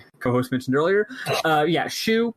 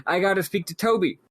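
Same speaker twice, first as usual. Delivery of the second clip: higher, hurried plea to get through; pushing past, a bit raised; urgent rushing voice; 7 semitones higher than usual. Retold or imitated. Messenger rushing to reach Toby, mildly urgent. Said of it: "rushes towards the castle saying".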